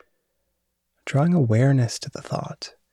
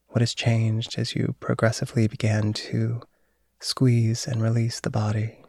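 guided meditation. Acted. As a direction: guide them into calm and sleep. Leads a body relaxation.

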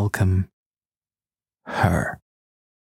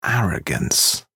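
scornful inner thought; slower than usual. A narrator's reading. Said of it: "I think to myself".

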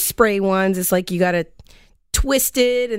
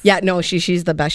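ordinary talk, nothing marked

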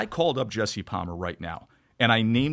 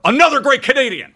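much higher and louder, a hammed-up proud cheer; mock praise; 13 semitones higher, 10 dB louder than usual. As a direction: oversell the compliment playfully. Mock praise for Jesse Palmer.

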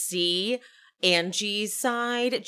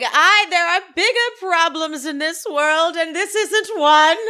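high, loud theatrical proclamation; Meredith; 7 semitones higher, 8 dB louder than usual. Retold or imitated. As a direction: declaim it like an outraged diva, slowing at the end. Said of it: "She's like".

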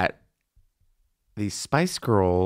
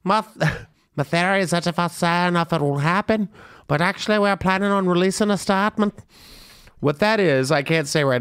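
badly faked thick British accent, pitched up; clumsy mock British accent; 7 semitones higher than usual. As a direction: do a hammy, higher-voiced British accent. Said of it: "That's the worst [name] accent".

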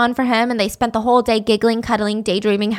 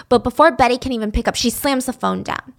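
usual speaking voice

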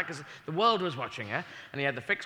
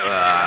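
loud mock scream; 9 dB louder than usual. Astronaut screaming offscreen.

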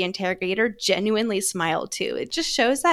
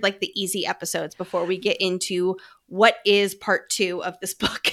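usual speaking voice

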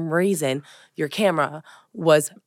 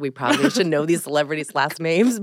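normal talking voice

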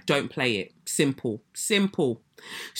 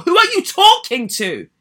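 higher, louder indignant challenge; 9 semitones higher, 6 dB louder than usual. Real, not acted. Confronting the nursery owner over her email, indignant. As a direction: demand it, affronted.

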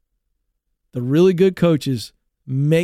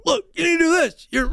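high, urgent imitation of a sideline coach; coach correcting; 11 semitones higher than usual. Coach to player at the sideline, urgent imitated correction. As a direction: mimic a coach's mid-game shout. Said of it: "he'd go".

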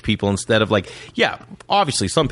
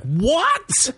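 much higher, astonished; kid amazement; 13 semitones higher than usual. Retold or imitated. Kids hearing a friend got a pool, astonished. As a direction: gasp it out like a wowed kid.

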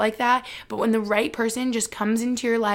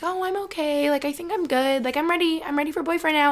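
lines pitched much higher, a light mock-casual girly voice; mock self-assured past self; 6 semitones higher than usual. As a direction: play her younger self pretending she's fine. Imitating her past single self.

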